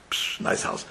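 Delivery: breathed out without voice; a hushed whisper. Retold or imitated. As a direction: whisper it in awe. Father admiring the house.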